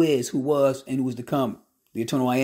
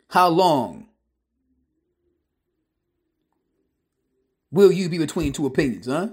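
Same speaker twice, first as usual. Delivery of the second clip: loud, pounding pulpit challenge; fiery preaching; 4 dB louder than usual. Acted. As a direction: thunder the question at the congregation.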